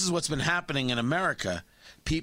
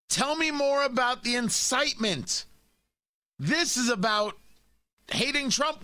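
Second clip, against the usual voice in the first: higher, taunting sneer at Ted Lieu; sarcastic mockery; 8 semitones higher than usual. Real, not acted. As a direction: needle him with scorn. Host mocking a congressman on air.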